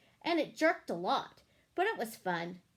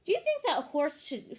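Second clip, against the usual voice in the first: plain reading voice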